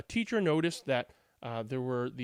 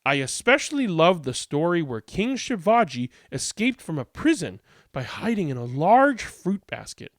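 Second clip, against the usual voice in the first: deliberate, animated storybook reading, louder on key words; expressive read-aloud with lilting emphasis; picture-book narration; 8 dB louder, slower than usual. A narrator's reading. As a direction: read aloud to children, lively and measured.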